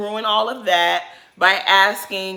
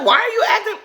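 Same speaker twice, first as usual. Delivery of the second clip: mocking high squeal; 13 semitones higher, 5 dB louder, faster than usual. Quoting Ashley mockingly.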